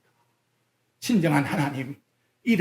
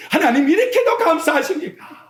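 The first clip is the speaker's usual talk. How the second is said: wailing thankful prayer; 7 semitones higher than usual. Retold or imitated. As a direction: pour out thanks like a weeping sinner. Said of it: "라고 통곡을 한번 해보았습니까".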